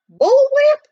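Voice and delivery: hammed-up indignant shout; snorting outburst. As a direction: explode at him in disbelief. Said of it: "he snorted".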